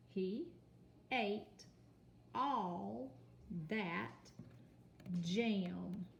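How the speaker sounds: each word set apart with pauses; word-by-word reading. Shown as a demonstration. Teacher reading the sentence one word at a time. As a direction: point to each word as you read. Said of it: "read your sentence".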